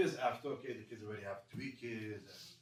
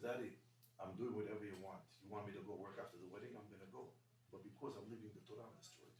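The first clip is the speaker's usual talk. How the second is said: much softer, sinking low; hushed and dejected; 4 semitones lower, 8 dB quieter than usual. Retold or imitated. Son answering his father at night, hushed and sad. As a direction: quietly admit you're crushed.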